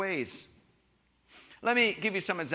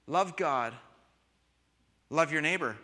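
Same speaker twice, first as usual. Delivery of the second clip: normal talking voice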